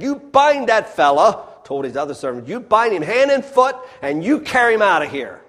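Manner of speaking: furious master ordering a servant bound; angry governor's order. Acted. Angry governor quoted in story.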